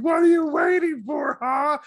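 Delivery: high, loud impatient shriek; shrill-pitched demanding yell; screaming. Acted. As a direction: scream it like an outraged patient. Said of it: "she's screaming".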